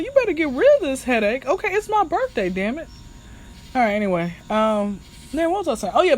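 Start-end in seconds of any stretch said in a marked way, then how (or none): 0.0-2.9 high-pitched playful insistence; mock exasperation. Birthday girl to man with headache, high mock exasperation. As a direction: demand it with comic indignation. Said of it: "I'm like, trying to Google".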